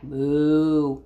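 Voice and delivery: low, drawn-out moo; cow moo. Acted. Teacher voicing the typing cows, low moo.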